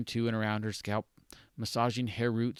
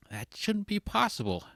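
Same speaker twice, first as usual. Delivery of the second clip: lightly voiced Heather; 11 semitones higher than usual. Heather reacts somberly.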